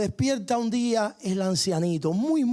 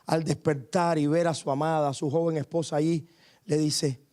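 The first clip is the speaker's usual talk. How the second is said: normal talking voice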